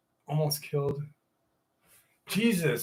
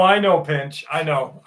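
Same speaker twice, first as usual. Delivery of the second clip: ordinary delivery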